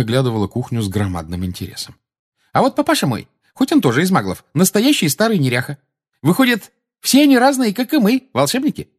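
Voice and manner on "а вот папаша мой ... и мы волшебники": put-on very high, loud, chatty young witch; Tonks, lively young witch. A narrator's reading. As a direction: chatter on brightly, pitched right up.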